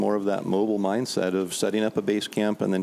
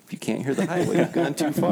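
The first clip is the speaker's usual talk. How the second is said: pitched-up mock drunk line; quoting the drunk; 7 semitones higher than usual. Hunters retelling the bum's advice, mocking. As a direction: deliver it as the running joke. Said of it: "bring that up".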